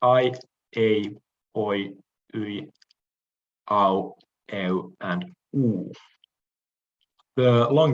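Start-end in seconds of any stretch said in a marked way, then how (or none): 0.0-5.9 "I, a, oi, ui, au, eu, and u" as separate Greek diphthong sounds; diphthongs demonstrated. Shown as a demonstration. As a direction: sound out each diphthong on its own, a short pause between. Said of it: "pronounced as follows".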